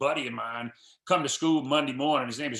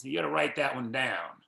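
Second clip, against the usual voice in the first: ordinary talk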